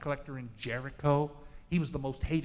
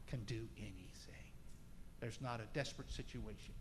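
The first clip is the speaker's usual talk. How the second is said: sudden whisper; 6 semitones lower, 13 dB quieter than usual. Real, not acted. Preacher whispering to the church about God's power, hushed. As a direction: drop to a whisper on the promise. Climax of declaration of faith.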